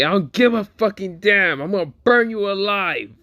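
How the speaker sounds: high-pitched, furious anime-hero outburst; pissed off. Acted. Yuji threatens Mahito in a retold fight, pissed off. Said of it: "Yuji is pissed and say".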